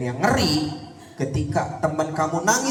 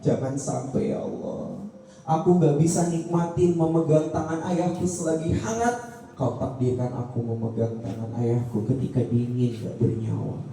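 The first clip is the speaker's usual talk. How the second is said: leading a prayer aloud. Shown as a demonstration. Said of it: "Saya tadi ngomong itu biar kamu ikutin". (doa yang dipimpin dengan lantang dan khusyuk)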